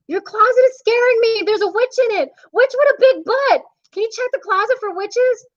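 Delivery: put-on high, frightened little boy; a kid spooked by the closet; scared child voice. Boy begging the monster to check the closet, high and scared.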